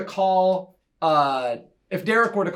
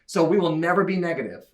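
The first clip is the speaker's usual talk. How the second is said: usual speaking voice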